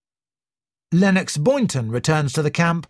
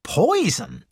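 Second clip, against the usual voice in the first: Poison pitched higher in shocked disbelief; a touch of shock on Poison; 4 semitones higher than usual. A narrator's reading. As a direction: let Poison land as a mild shocked echo. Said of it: "He looked completely shocked".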